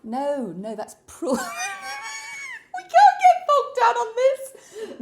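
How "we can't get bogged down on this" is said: high, loud, slow words through tears of laughter; real helpless laughter; laughing fit. Real, not acted. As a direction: crack up while trying to speak. Laughing fit mid-spelling.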